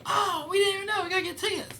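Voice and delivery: high, bright mock girl voice; girl at a bar. Girls at a bar asking about his show, mock high voice. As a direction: squeak it like an excited fan. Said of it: "They go".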